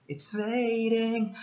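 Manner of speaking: It's fading said very high and slow, fading long and floating; swooping high slow It's fading; drawn-out fading. Shown as a demonstration. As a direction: stretch out fading up high as if it fades away. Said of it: "it's like it's fading".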